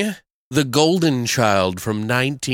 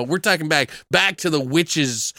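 ordinary talk

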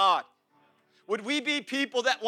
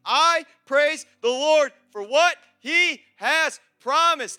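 loud, raised, driving sermon voice; impassioned preaching; 6 semitones higher, 8 dB louder than usual. Real, not acted. Preacher vows to praise God without a band, impassioned preaching. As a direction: preach it at full force, building with each promise.

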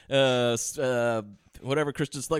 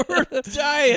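ordinary talk

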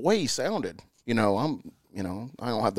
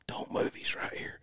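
urgent hushed warning; whispering; 11 dB quieter, slower than usual. Retold or imitated. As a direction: breathe it so the elk won't hear. Whispering to partner near elk.

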